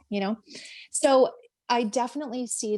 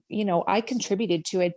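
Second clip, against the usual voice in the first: ordinary talk, nothing marked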